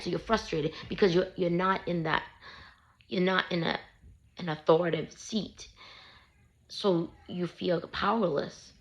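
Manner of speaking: normal talking voice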